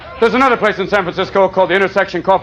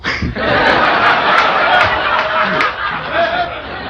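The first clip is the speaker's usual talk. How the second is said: unvoiced high breathy cackle; breathy laugh; 6 semitones higher than usual. Real, not acted. Comic cracking up at his own cowboy joke, breathy laugh. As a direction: let a wheezy laugh out.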